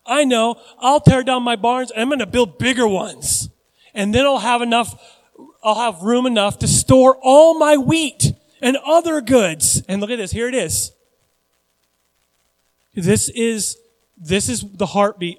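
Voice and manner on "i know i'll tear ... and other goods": loud, raised-pitch mock enthusiasm; hammed-up boasting, pitched up and punched; not-so-brilliant schemer. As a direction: play the rich fool proud of his big plan. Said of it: "this guy's not really that brilliant".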